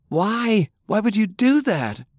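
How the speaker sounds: very high, anguished questioning; anguished disbelief. A narrator's reading. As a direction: demand a reason, voice pitched right up. Francis appalled at the confession.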